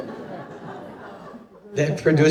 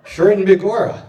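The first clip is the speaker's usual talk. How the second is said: put-on Irish accent; 5 semitones higher, 7 dB louder than usual. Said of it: "How did you know I was Irish?".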